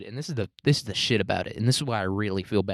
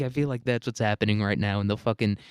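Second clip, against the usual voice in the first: normal talking voice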